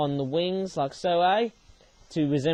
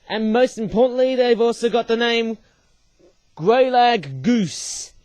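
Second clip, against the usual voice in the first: louder and higher, Greylag Goose slowly stretched; drawn-out name; 6 semitones higher, 6 dB louder than usual. Real, not acted. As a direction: announce "Greylag Goose" big and slow, like a title. Proudly announcing the model's name.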